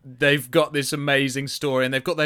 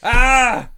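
high, loud cry of mirth; laughing shriek; 14 semitones higher, 12 dB louder than usual. Real, not acted. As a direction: let out a high whoop after laughing. Cracking up at leg joke.

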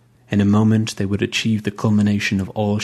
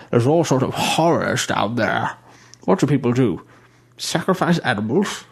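put-on fussy old bishop; 3 semitones higher than usual. A narrator's reading. Bishop disgusted by water.

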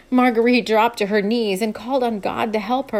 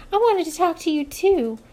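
put-on young woman voice; 7 semitones higher than usual. Amber voiced.

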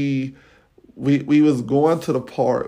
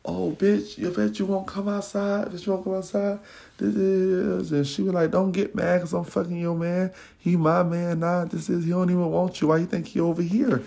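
higher, jeering trash talk, lightly voiced; mock taunting rant; 6 semitones higher than usual. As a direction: taunt a rival through the window. Foster daughter yelling at window.

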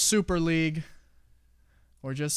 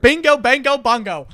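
high, loud sing-song cheer; excited cheer; 10 semitones higher, 13 dB louder than usual. Real, not acted. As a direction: celebrate the right answer. Celebrating the correct answer.

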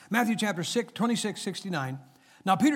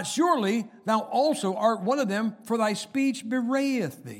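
loud accusation; 7 semitones higher, 6 dB louder than usual. Acted. Bystanders accuse Peter, preached.

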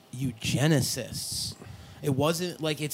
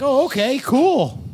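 high, loud mock-satisfied reply; pitched-up pretend agreement; mock acceptance; 9 semitones higher, 9 dB louder, slower than usual. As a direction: play someone cheerfully buying the excuse. Acted gullible listener reaction.

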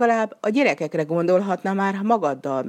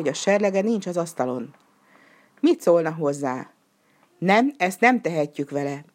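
plain reading voice

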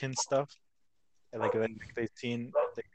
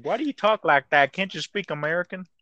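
a hint of an indignant louder voice; lightly voiced brash American demanding; mock rude American; 6 semitones higher, 8 dB louder than usual. Imitating Americans at British people, mild mock indignation. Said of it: "Like, like".

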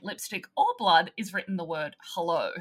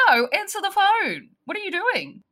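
high, loud exasperated demand; 11 semitones higher, 7 dB louder than usual. Retold or imitated. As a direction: scold him for not answering. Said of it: "I'm like".

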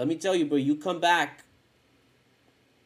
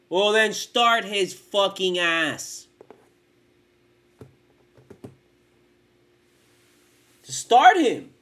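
angry; 6 semitones higher, 8 dB louder, much slower than usual. Real, not acted. Angry rant about coach.

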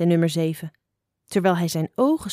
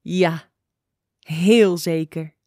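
slow, deliberate answer with a grin; self-assured, grinning reply; 2 semitones lower, 3 dB louder, much slower than usual. A narrator's reading. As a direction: grin as you confirm it, drawing it out. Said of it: "antwoordde met een grijns".

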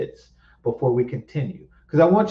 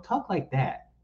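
ordinary talk, nothing marked

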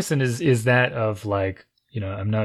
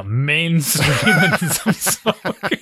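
laughing; 3 semitones higher, much slower than usual. Pretend. Joking about smoking.

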